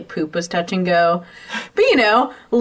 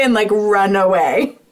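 ordinary talk, nothing marked

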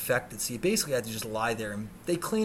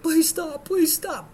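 high, loud panicked begging; desperate pleading; 7 dB louder, slower than usual. Retold or imitated. Man begging attacking dogs to stop, desperate scream. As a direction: beg at a near-scream.

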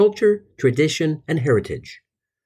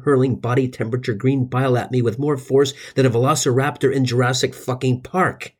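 normal talking voice

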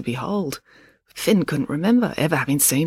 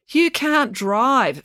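slow pointed reminder; 7 semitones higher, much slower than usual. A narrator's reading. Finn reminding dad.

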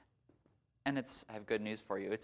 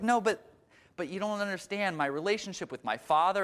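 protesting patient; 7 semitones higher, 3 dB louder than usual. Retold or imitated. Woman protests in skit.

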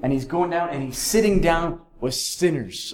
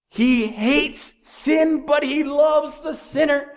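slow, higher, louder proclamation; preaching climax; 10 semitones higher, 3 dB louder, slower than usual. Real, not acted. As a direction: ring it out to the congregation.

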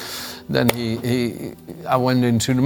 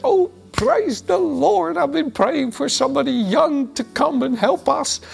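delighted church lady; 14 semitones higher than usual. Retold or imitated. Preacher imitating Geri, thrilled that a young helper came, high and delighted. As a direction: lift the pitch way up like a beaming church lady thanking God.

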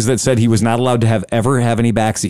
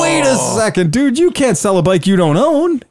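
much higher, startled wait-a-second; surprised protest; 11 semitones higher than usual. Retold or imitated. Learns he still owes on bike.

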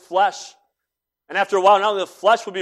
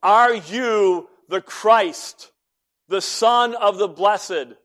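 raised, drawn-out demanding question; exasperated high priest; 5 semitones higher, 4 dB louder, slower than usual. Retold or imitated. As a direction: demand an answer, stretching each phrase. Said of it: "And now, exasperated".

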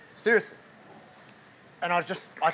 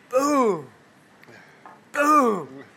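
loud boom; 7 semitones higher, 8 dB louder than usual. Retold or imitated. Retelling back blows, sound effect.

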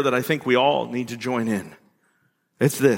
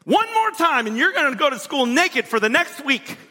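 much higher, exaggerated parent threat; mock angry parent; 11 semitones higher than usual. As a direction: play the fed-up parent laying down the law, hammed up. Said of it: "We tell our kids".

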